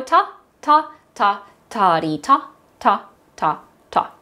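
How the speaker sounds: evenly spaced spoken beats; rhythm syllables. Shown as a demonstration. Teacher repeats the rhythm with class, chanting. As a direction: chant it in strict time. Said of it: "Do it again".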